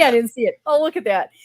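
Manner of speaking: usual speaking voice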